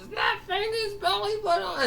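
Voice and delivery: very high cry of disbelief; shocked yell. Acted. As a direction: squeal the question in mock shock.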